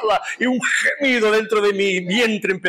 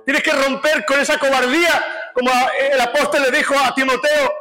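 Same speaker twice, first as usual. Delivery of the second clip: high-pitched, driving exhortation; impassioned preacher's raised voice; fervent preaching; 6 semitones higher than usual. Acted. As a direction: urge the congregation on, phrase by phrase. Exhorts the congregation.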